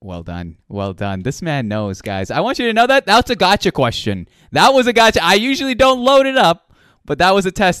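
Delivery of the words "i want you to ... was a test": loud, high-pitched triumphant reveal; big game-show style exclamation; excited announcing. Real, not acted. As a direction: hype up the crowd, voice jumping high. Host celebrates guest passing trick question.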